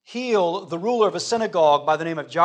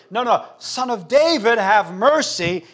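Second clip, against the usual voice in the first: crying out the more; 6 semitones higher, 8 dB louder than usual. Acted. Hushed beggar insisting to Jesus, crying out louder. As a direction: refuse to be hushed, call louder and higher. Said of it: "he cries out the more as they hush him".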